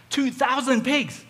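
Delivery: high, loud, drawn-out disbelief; astonished exclamation. Retold or imitated. Disciple spotting pigs on the hillside, astonished cry. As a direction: exclaim it slowly, pitch way up.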